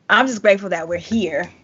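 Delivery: usual speaking voice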